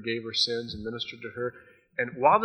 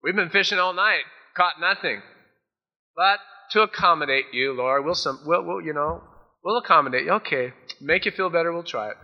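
loud, high grumbling protest; skeptical fishermen; 4 semitones higher, 6 dB louder than usual. Acted. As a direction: humor the carpenter grudgingly. Fishermen's skeptical reply voiced.

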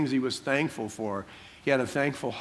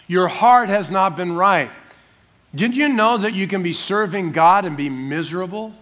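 normal talking voice

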